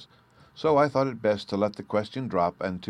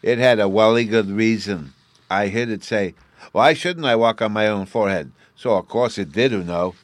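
put-on louder, higher boastful child voice; proud little boy; 4 semitones higher, 10 dB louder than usual. A narrator's reading. Bruno's child voice, loud.

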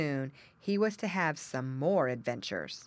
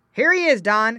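loud, high farmer's call; 6 semitones higher, 12 dB louder than usual. A narrator's reading. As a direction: call the dog over to the find. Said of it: "called the farmer".

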